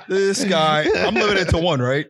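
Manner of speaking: ordinary delivery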